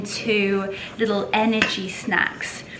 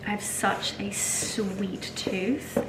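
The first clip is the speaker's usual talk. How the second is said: slow whisper; 7 dB quieter, slower than usual. Real, not acted. Confiding aside to viewers.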